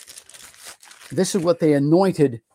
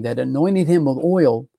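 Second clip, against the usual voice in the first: normal talking voice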